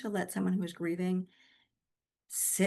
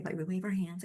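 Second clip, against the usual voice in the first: ordinary delivery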